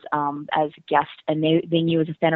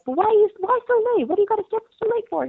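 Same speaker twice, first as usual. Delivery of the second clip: mother-in-law; faster than usual. Retold or imitated. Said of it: "she was like".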